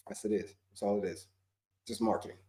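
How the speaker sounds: quiet aside. Real, not acted. Muttered aside to self.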